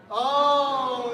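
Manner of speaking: a sudden loud oh of surprise; loud surprised oh. Real, not acted. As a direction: burst out with a surprised oh. Game answer revealed as fake.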